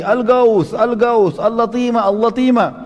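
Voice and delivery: hammed-up alarm call, pitched high; retold cry of alarm. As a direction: cry out the alarm as the messenger crying for help. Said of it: "teriak lagi".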